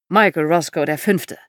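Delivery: put-on lightly American-accented older man. A narrator's reading. Older visitor introduces himself to the daughter, light American accent. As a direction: introduce yourself firmly with a hint of American accent. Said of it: "Er sprach mit amerikanischem Akzent".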